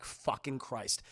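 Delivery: low, slow exasperated curse; pissed off. Retold or imitated. Editor learns the photo is fake, low slow exasperation. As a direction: curse slowly under the breath. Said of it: "J K Simmons is pissed".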